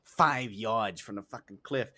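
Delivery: ordinary talk, nothing marked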